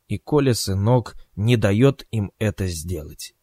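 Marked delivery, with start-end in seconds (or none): none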